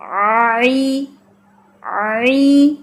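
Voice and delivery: آئی drawn long from the throat; long guttural آئی; stretched ain-yaa. Shown as a demonstration. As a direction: pull آئی out long and thin. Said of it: "آواز کو کھینچ کے لمبا کریں گے".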